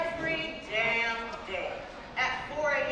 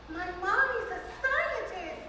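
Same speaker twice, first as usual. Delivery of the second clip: put-on high-pitched child's voice, full of pride; proud little girl; 10 semitones higher, 6 dB quieter than usual. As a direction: imitate a six-year-old bragging about mom. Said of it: "I loved how proud she sounded".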